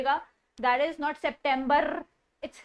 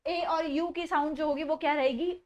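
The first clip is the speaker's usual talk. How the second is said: usual speaking voice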